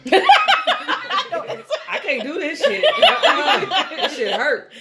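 high, loud, drawn-out laughing wail; mock labor agony. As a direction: stretch each word like a mock labor pain. Labor pain retold.

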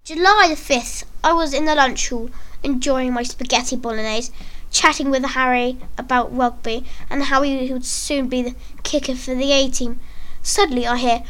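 ordinary delivery, nothing marked

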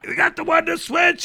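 very high, loud referee call; retold yell. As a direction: re-enact shouting to someone hard of hearing.

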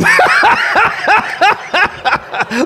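helpless burst of laughter; high whooping laugh; loud high laugh. Real, not acted. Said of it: "I'm laughing".

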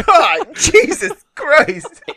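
high, slow, laughing God, Jesus Christ; loud laughing exclamation; laughing disbelief. Real, not acted. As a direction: crack up in disbelief.

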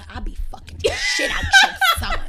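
hammed-up shameless announcement; laughing through a pretend confession to his mother; brash mock boast. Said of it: "Imagine me going to somebody's mother".

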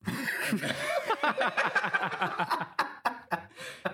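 very high, loud evil cackle; villain cackle. Acted. Dennis gloating over the fallen Ozzy, high evil cackle. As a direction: cackle with glee at your victim.

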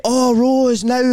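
high, loud gushing callout; mock live-stream host. Acting out live-stream gift thanks.

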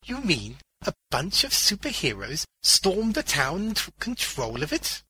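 put-on high-pitched, astonished old man; incredulous question. A narrator's reading. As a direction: ask in disbelief, rising to the end. Old man disbelieves the news.

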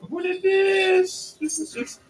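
surprised. Real, not acted. Reacting to a new card.

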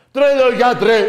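very loud, high mock holler; hammed-up shout. Host mock-calling to a crazed psychiatrist, hammed-up yell. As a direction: yell it as a punchline. Mock cry at the maddened doctor.

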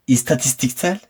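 normal talking voice

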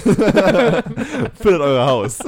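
high, loud, slow laughing slogan. Pretend. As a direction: call it out while laughing.

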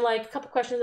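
ordinary delivery

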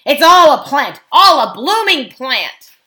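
loud, high, riled dwarf heckler; rowdy dwarf accusation; growling dwarf. A narrator's reading. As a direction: shout it out like an angry dwarf. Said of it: "growled another dwarf".

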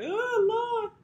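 hammed-up lament; dramatic lament. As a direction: throw up hands in despair. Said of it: "I probably would've been like".

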